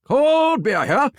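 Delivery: slow, stretched, very high ballpark call; drawn-out stadium vendor hawking. Shown as a demonstration. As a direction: stretch every word like a vendor in the stands. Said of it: "Let's hear what you got".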